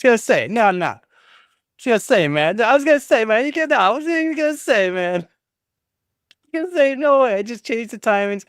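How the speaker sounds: high squeaky cartoon voice, stretched out. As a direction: squeak it out like a silly cartoon character. Playful squeaky put-on voice at friend.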